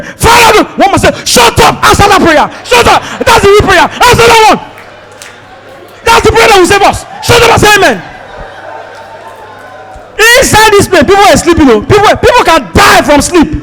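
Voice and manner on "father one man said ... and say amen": shouting prayer. Retold or imitated. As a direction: command the others to shut up. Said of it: "One guy was praying".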